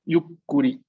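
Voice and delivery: Yukuri con un corte marcado antes de la K; detén el aire y suelta la K de Yukuri; K duplicada. Shown as a demonstration. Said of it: "nos va a duplicar la K". (pronuncia Yukuri con K doble y firme)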